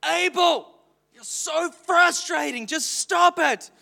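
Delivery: high, slow exasperated scolding; frustrated parent. Retold or imitated. Father retelling how he snapped at his toddler, exasperated. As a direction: let the frustration out on the child. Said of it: "I get so frustrated".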